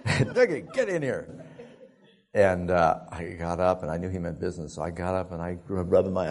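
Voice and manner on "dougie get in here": put-on raised-pitch call to a child; dad's summons. Dad calls his son from the hall, mock summons. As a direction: summon the boy like a stern dad.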